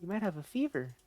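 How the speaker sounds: sweetly worried, a bit fast; caring Anna. Acted. Anna fusses over Jack.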